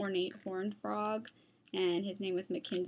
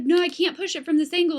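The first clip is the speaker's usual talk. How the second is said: louder and higher, stuck and frustrated; straining shout; 6 semitones higher, 11 dB louder, faster than usual. Retold or imitated. Laura shouting from outside the door, frustrated. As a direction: shout it like you're shoving a jammed door. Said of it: "She's like".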